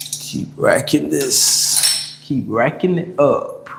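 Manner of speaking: slow, breathy words forced out under load; strained mid-lift. Real, not acted. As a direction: talk through the effort of the rep. Talking while lifting weights.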